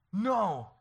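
put-on high, loud childish shout; hammed-up pouting yell; mock defiant refusal. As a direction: refuse like a stubborn little kid. Acts child refusing God.

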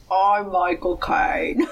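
slow impression through a mouthful of popsicle; Michael Caine. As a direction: do a famous actor with a popsicle in your mouth. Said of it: "your Michael Caine impression with the popsicle".

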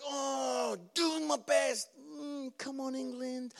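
high, soft, strained mock cheer; reluctant support. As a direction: cheer half-heartedly, as if it costs you. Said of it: "trying to support them, doing my best".

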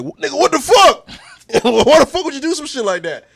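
high, loud Amos impression, mid-laugh. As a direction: yell it like Amos, cracking up. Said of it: "doing the Amos voice".

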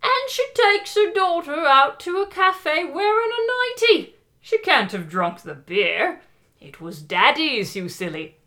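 high, slow hammed-up British charwoman; British accent like a Monty Python housewife, indignant; mock-posh British lady, scolding. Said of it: "more like the charwomen from Monty Python".

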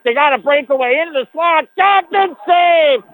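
rising, urgent hockey call; excited play call. Real, not acted. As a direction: call the save with a jump in energy. Breakaway and save, live call.

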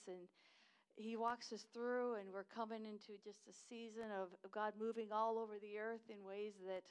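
ordinary talk

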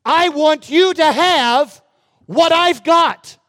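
high, loud, slow offering; Paul offering what he has, fervent; Paul's generous cry. Retold or imitated. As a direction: cry out the gift with joy. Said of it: "the Apostle Paul is saying".

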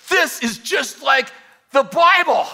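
slow, high, loud amazed exclamation; excited shout. Retold or imitated. Danny shouting in awe at the demon, excited yell. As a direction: belt it out in thrilled wonder. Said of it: "And Danny goes".

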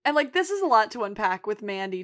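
ordinary talk

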